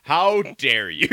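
slow, drawn-out mock outrage; hammed-up scandalized reply; O'Neill. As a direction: stretch each word in indignation. Acts O'Neill's outrage at war crimes.

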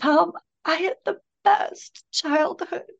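high, choked-up voice of her son crying on the phone; retold crying. Son calling mother from jail, tearful and high. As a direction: let the voice rise and tighten as if fighting tears. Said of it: "and then he starts crying".

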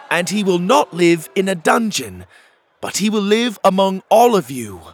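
loud, high proclamation; declaring loudly. Acted. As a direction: declare over the crowd. Pockets declares over the crowd.

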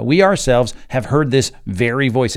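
loud stress on "We ourselves" and "very"; very emphatic. Shown as a demonstration. Preacher repeating the verse to his listeners, very emphatic. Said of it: "Again, a very emphatic construction".

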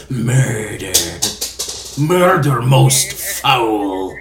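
slow, loud ominous pronouncement; dramatic ominous voice. Acted. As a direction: drag it out like a ghostly omen. Torbjorn pops out from under bed.

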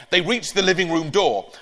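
plain reading voice

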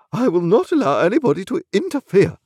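high, affronted refusal; imperious lady refusing to be crossed; haughty indignant wife. A narrator's reading. Wife haughtily rebuffs husband.

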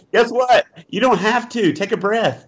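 high, bright self-reassurance; upbeat voice telling himself to relax; mock pep talk. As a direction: chirp it up like a cheerful inner coach. Coaching self to calm down.

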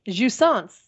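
"Jouissance" said the French way, zhoo-ee-SAHNSS; proper French "Jouissance"; French pronunciation. Shown as a demonstration. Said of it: "pleasure and enjoyment".

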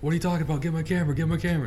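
high, loud insistent demand; mock frantic grab for the camera.

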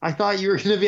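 high, laughing relief in a mock voice; lightly hammed-up relieved buddy; relieved friend. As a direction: imitate him, laughing with relief. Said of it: "He's like".

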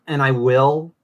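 normal talking voice

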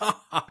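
mock villain laugh, pitched high; evil laugh. Joking about laughing when it happens, mock evil laugh. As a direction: put on an evil cackle. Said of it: "I'm, like, evil laughing".

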